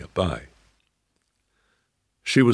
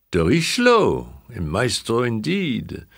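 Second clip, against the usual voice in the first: put-on old French lady; 6 semitones higher, 4 dB louder than usual. A narrator's reading. Old lady repeats name.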